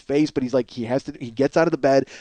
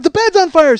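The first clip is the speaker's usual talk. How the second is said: high, loud panicked cry; urgent pitched-up yell; acted alarm; 8 dB louder than usual. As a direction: mimic kids shouting a warning. Children raise the alarm.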